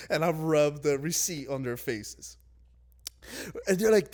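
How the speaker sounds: ordinary talk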